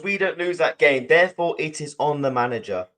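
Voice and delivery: ordinary delivery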